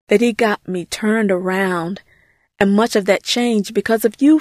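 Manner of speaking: ordinary delivery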